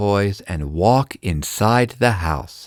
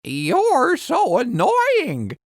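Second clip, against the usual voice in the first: high, slow parrot echo; parrot copying; 13 semitones higher, much slower than usual. Acted. Parrot copying angry Ella, high parrot voice. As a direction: mimic her annoyance like a parrot. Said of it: "Parrot repeats".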